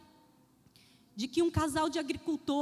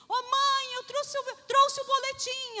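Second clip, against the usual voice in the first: put-on high, louder child's voice; eager child; 9 semitones higher, 5 dB louder than usual. Son calls his mother, high childlike voice. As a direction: sound like a kid bringing his report card.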